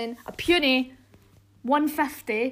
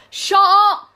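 shouting; 8 semitones higher, 11 dB louder than usual. Real, not acted. Yells at interrupting brother.

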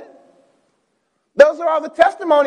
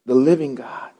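solemn reading; 10 semitones lower, 7 dB quieter than usual. Acted. Preacher reads psalm slowly, low.

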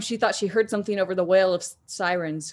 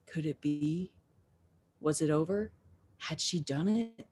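hushed; 3 semitones lower, 9 dB quieter than usual. A narrator's reading. Hiding, wondering in silence.

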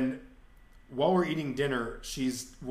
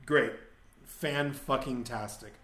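usual speaking voice

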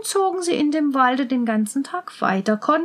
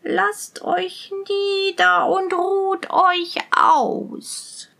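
high, very slow witch's rasp; falsely friendly witch; 6 semitones higher, much slower than usual. A narrator's reading. Old witch inviting strangers to rest, feigning friendliness. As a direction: coax them in with sugary, creaking slowness. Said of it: "und tat ganz freundlich".

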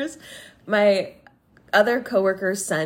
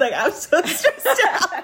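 high, laughing mock distress; 10 semitones higher, 3 dB louder than usual. Retelling her fire truck panic to co-host, laughing. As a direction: wail it lightly through laughter.

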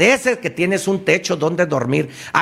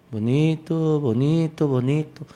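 bonito repeated, softer each time; fading echo; 4 dB quieter than usual. Shown as a demonstration. The echo returning the word, fading softer. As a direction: let bonito fade away like an echo. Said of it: "Y él te escucha y te grita".

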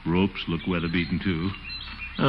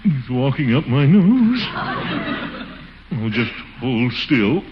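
slow, strained, higher whisper-still voice; tense and slow; 6 semitones higher, 3 dB louder, slower than usual. Acted. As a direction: force yourself to keep calm. Bee walking up his nose.

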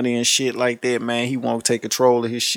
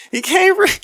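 laughing squeal; 3 dB louder than usual. Real, not acted. Laughing disbelief.

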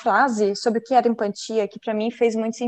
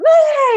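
high, loud cartoon bawl; loud, exaggerated crying cry; mock wail; 10 dB louder than usual. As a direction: imitate a little elephant bursting into tears. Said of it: "ele começa a chorar mais ainda".

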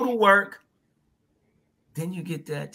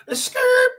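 high yelp; 5 dB louder than usual. Retold or imitated. Exclamation after bragging quote.

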